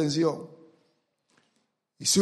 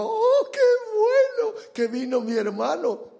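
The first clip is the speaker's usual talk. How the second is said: much higher, sarcastic fake joy; elder brother feigning gladness, much higher and mocking; mock delight; 12 semitones higher than usual. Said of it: "bien contento le dijo".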